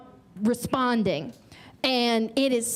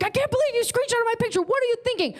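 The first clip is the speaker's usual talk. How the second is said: much higher, fast, indignant; mock outraged guy; 10 semitones higher, much faster than usual. Comic imitating the angry Tinder guy, high and fast. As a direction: squeal his outrage in a hurry. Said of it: "He was like".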